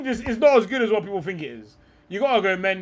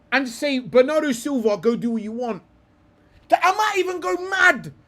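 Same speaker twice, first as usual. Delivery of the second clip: rising excitement, voice much higher; excited; 6 semitones higher than usual. Real, not acted. Picking his Portugal team, gets worked up about the tactic. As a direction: pitch climbing, getting hyped.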